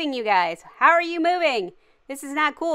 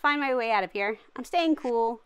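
usual speaking voice

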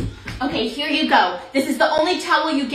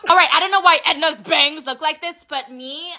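ordinary delivery

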